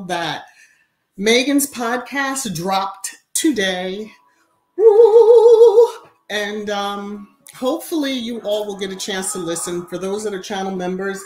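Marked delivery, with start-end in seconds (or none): 4.8-5.9 very high, very loud whoop of joy; celebratory whoop. Real, not acted. Announcing Megan's podcast dropped, a very high, loud whoop. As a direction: let out a big celebratory cheer.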